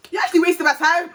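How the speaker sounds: half-joking irritation, pushed up high; annoyed complaint. Retold or imitated. Annoyed thought at the nurse.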